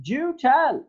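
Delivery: "Do tell" lifted high and loud; rising tone. Shown as a demonstration. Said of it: "the rising tone is marked".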